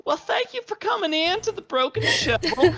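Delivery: high, bubbly Southern barmaid greeting; chirpy barmaid voice. Acted. Barmaid Boo Boo greets the party at the door, high and bubbly. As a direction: belt it out like a cheerful tavern waitress welcoming guests. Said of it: "and she's like".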